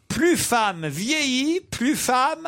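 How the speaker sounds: high, slow, suspended headline read; quiz clue reading. Real, not acted. Host reading a headline for panellists to complete, quiz clue reading. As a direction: draw it out and leave the end hanging.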